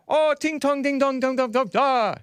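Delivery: high, fast mock Chinese gibberish; hammed-up fake Chinese sounds. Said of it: "he pretended to speak Chinese".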